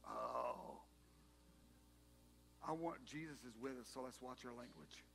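low, hushed grumbling among friends; muttered aside. Acted. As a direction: mutter it under your breath. Disciples mutter as Jesus approaches.

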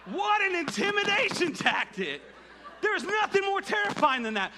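high, loud, incredulous marveling; awed exclamation. Real, not acted. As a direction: exclaim it in hyped amazement. Marvels at the lineman's stunt.

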